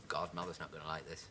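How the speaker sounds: dreading mutter. Acted. Muttering dread about mother.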